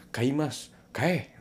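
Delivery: high, loud "kae" barked out; harsh command. Shown as a demonstration. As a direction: snap the command "kae" like a boss. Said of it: "itu perintah keras".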